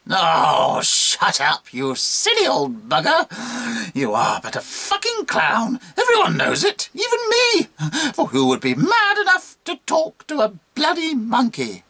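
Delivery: high, squealing monkey tirade; exasperated monkey. Acted. Monkey telling the bishop to shut up, shrieky exasperation. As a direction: rant upward into a squeal.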